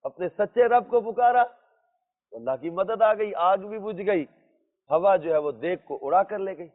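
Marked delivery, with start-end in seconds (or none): none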